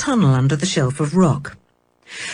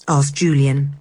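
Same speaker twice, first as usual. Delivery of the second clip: usual speaking voice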